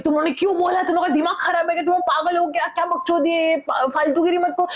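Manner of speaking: high-pitched, rapid-fire telling-off; retold scolding. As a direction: scold your friends in mock outrage. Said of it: "मैं इन लोगों पे बरसी".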